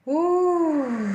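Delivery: long, high, falling whoop; high "ooh". Real, not acted. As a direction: let out a high, drawn-out ooh. Reaction during intense reading.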